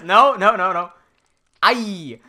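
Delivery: imitated Australian lecturer, emphatic correction; Australian accent. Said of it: "That's why I struggle with this accent".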